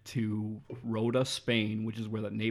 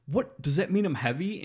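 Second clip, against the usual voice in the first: offended; 7 semitones higher, 5 dB louder than usual. Retold or imitated. Retold woman offended at weight.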